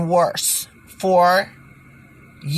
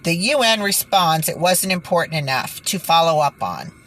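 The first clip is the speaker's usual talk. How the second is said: usual speaking voice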